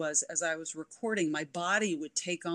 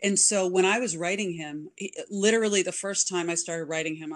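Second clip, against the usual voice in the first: ordinary delivery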